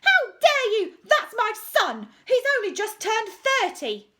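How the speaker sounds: high, loud, outraged scream; furious high shriek; screaming referee. A narrator's reading. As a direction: shriek it at the top of the voice. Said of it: "screamed the referee".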